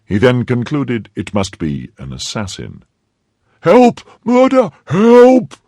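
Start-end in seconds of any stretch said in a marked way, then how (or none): 3.6-5.6 high, loud screams for help; panicked cry. A narrator's reading. Servant believes he faces an assassin, panicked cry. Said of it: "cried he".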